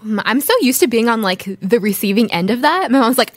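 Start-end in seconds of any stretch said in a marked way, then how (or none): none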